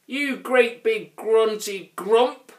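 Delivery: high, slow, loud troll insult; snappy little troll. A narrator's reading. Grouch insults the Grunt, high and snappy. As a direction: lay into him slowly in a high voice. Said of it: "snapped the Grouch".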